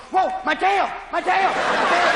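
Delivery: high, excited dog voice; stoned dog. Acted. Stoned dog discovering its tail, high yelping voice. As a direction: yelp it high in mock alarm. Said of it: "And your dog, you go".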